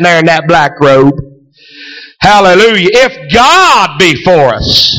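fervent preaching. Acted. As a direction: preach it out with fire. Preaching at full force.

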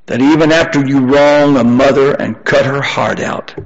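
usual speaking voice

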